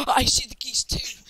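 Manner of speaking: high, loud silly impersonation; exaggerated mock excitement; mocking imitation. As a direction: tease your friend with a high, loud copy. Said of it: "then you were like".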